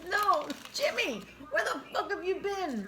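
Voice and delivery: high, slow laughing mock outrage. Teasing Jimmy on the phone, high laughing outrage. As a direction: tease him, half laughing. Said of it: "I'm like".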